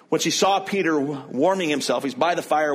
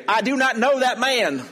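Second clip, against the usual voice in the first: hammed-up Texas accent, higher and louder; Texan drawl; 10 semitones higher, 4 dB louder than usual. Texan actor playing Peter in an Easter cantata, hammy Texas accent. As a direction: ham it up like a Texan church actor. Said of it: "a bad accent of an actor".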